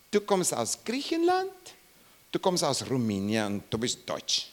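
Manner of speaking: ordinary talk, nothing marked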